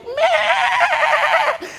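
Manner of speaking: shouted, high sheep cry; lamb bleat. Retold or imitated. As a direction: bleat like a lamb being slaughtered. Lamb bleating while slaughtered.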